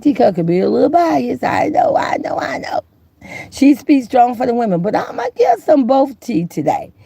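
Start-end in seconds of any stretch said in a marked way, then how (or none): 1.4-2.8 squeaky mock protest. Real, not acted. Mock self-defence about bias.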